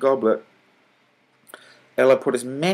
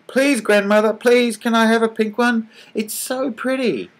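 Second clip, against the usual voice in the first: put-on much higher, childlike begging; little girl pleading; 13 semitones higher, 4 dB louder than usual. A narrator's reading. Girl begging grandmother for a bracelet, pleading child voice. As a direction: beg like an eager little girl.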